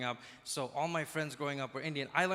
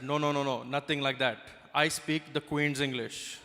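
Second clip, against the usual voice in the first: put-on dismissive Indian-accented English, slowing at the end. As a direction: proudly correct in an Indian accent. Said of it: "the most Indian thing I've ever heard".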